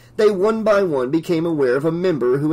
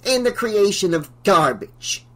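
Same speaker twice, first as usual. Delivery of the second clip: higher, fierce scornful addendum; 6 semitones higher than usual. A narrator's reading. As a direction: tack it on with fierce contempt. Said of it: "she added fiercely".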